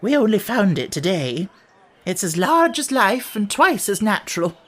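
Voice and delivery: eager. A narrator's reading. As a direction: show off a marvellous new find. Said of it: "Hare replied eagerly".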